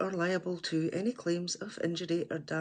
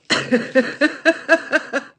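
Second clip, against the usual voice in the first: loud high falling cackle; 10 semitones higher, 12 dB louder than usual. Real, not acted. Reacting to a viewer joke, loud cackle.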